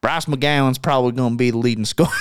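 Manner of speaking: stuck in a put-on Southern twang; Southern accent. Host joking he can't drop it, playful Southern accent. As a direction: keep up the mock Southern accent. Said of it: "the whole podcast talking like this".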